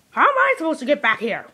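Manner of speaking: ordinary talk, nothing marked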